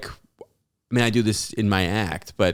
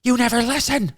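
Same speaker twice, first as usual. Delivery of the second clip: much higher shrill scolding mom voice. Retold or imitated. Mom scolding dad over the forgotten box, high nagging. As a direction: nag him in a high mother's voice. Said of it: "My mom goes".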